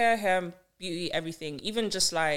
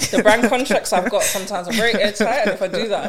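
louder, higher, laughing through it; loud laughing rant; 3 semitones higher, 12 dB louder than usual. Real, not acted. Loud laughing rant about contracts.

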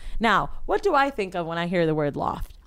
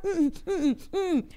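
high-pitched closed-mouth mm-mm; high mumbled hums, mimicking someone unable to talk; wordless drugged humming; 10 semitones higher, much slower than usual. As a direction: act out trying to answer without words. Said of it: "I still can't talk".

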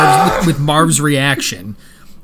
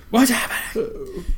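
put-on confused girl; 8 semitones higher, 5 dB quieter, slower than usual. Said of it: "like the girl in Poltergeist".

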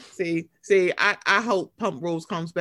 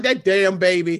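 mock grumble; 2 semitones lower, 6 dB louder than usual. Mocking friend's complaint.

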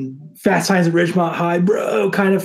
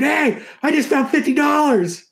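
high excited shout; screaming; 9 semitones higher than usual. Retold or imitated. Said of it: "and I screamed".

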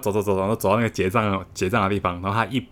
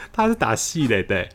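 usual speaking voice

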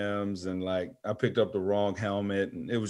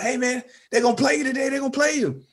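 high, fast, overexcited buddy voice; excited friend; 3 dB louder, faster than usual. Retold or imitated. Teammate Speedy hyping him up, high and fast. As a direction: squeal it like a hyped-up friend. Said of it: "the friend that just is always overly excited".

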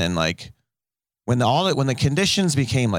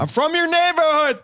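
much higher, nasal-free squeaky fan voice; high excited voice of a crowd member; imitating an audience member. Comic mocking a crowd member's hometown claim, very high voice. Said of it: "They're like".

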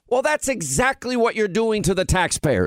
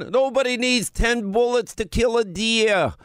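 mocking Cuomo; 6 semitones higher than usual. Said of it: "my favorite line".

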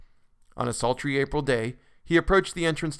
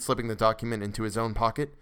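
usual speaking voice

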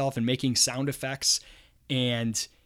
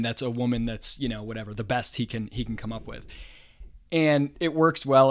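ordinary talk, nothing marked

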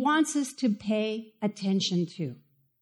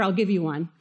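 normal talking voice

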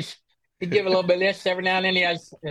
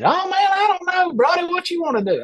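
mock Benny voice; 12 semitones higher, 4 dB louder than usual. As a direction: put on a much higher voice for the friend. Acting out Benny's reply in story.

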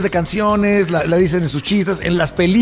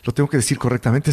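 hushed, nervous muttering to himself; 6 semitones lower, 4 dB quieter than usual. Retold or imitated. Retelling Will Ferrell's scene, quiet and nervous. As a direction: talk himself through it, worried he'll slip. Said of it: "Se pone todo nervioso".

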